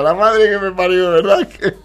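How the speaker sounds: cracking up, barely speaking; real laughter. Real, not acted. Vlogger reacting to the clip, genuinely cracking up. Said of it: "No puedo".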